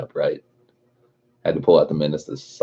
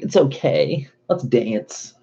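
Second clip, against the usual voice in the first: mocking mom; 7 semitones higher, much slower than usual. Mocking mom's reaction.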